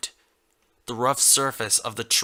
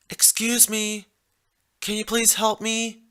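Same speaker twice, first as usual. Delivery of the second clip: put-on higher, pleading young mare; Red Heart asking for help; 10 semitones higher than usual. A narrator's reading. Injured mare calls to a passing stallion, higher pleading voice. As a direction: ask a stranger for help from the ground. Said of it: "Red Heart asked".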